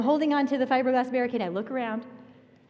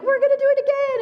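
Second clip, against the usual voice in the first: put-on excited kids; 10 dB louder, much faster than usual. Daughters racing down after ride.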